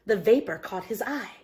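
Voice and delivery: usual speaking voice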